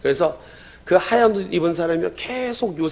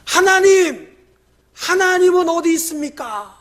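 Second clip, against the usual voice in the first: high, loud desperate outcry; anguished cry to God; 12 semitones higher, 7 dB louder, slower than usual. Retold or imitated. Doubting hero crying out to God, loud anguished shout. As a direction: cry out to heaven in despair. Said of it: "항상 외치는 게".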